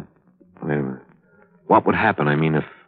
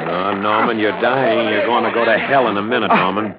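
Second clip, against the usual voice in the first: taunting; 8 semitones higher, 4 dB louder than usual. Acted. Killer taunting dying man.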